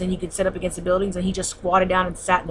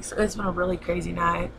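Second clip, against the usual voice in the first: ordinary talk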